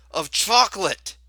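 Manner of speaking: rising shocked cry on the last words; horrified gasp. A narrator's reading. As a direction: gasp it out in horror. Mother turned to chocolate.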